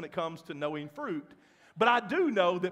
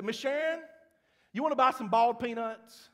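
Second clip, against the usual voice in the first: playful Cajun voice asking a favor; Cajun accent; 5 semitones higher, 6 dB louder than usual. Retold or imitated. Pastor imitates Cajun churchgoer selling peanuts, Cajun accent. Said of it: "he has an accent".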